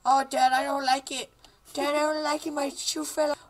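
put-on high-pitched kid whine; whining son. Son complaining to dad about the game, high whine. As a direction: complain like a distressed little boy.

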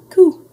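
very high, loud coo; sudden piping infant coo; baby ghost coo. A narrator's reading. Baby Ghost startling the visitor, cooing loudly.

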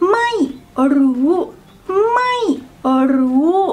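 ไม่ falling from high, then รู้ climbing up high. Shown as a demonstration. Teacher practising South Pole to North Pole, falling then high tone. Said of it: "when you practice the falling ... go to the North Pole".

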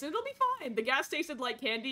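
usual speaking voice

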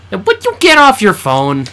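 loud, fed-up demand; exasperated call-out. Real, not acted. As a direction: snap her off the phone. Nagging partner on phone.

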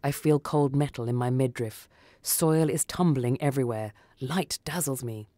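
plain reading voice

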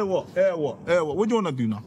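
ordinary delivery

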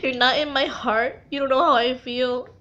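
normal talking voice

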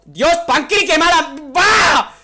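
high, loud shout at a teammate; outraged yell; furious shout. Real, not acted. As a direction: blow up at your friend. Nearly killed, yells at friend.

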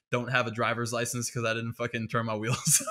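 normal talking voice

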